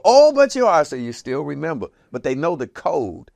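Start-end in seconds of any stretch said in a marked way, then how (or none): 0.1-0.8 high loud mocking echo; people quoting him. Passersby teasing J-Dub with his old line, loud high echo. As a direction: throw the famous line back loudly. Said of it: "they go".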